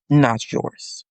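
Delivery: ordinary talk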